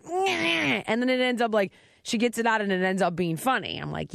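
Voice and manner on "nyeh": imitating child. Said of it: "she's like".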